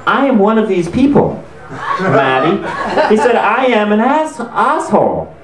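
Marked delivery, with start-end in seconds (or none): 0.1-2.6 higher, halting declaration; hammed-up offended earnestness; mock intense. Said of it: "he's getting intense". 3.4-5.3 high, slow, hammed-up declaration; imitated host, worked up; mock intense confession. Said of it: "he's getting intense".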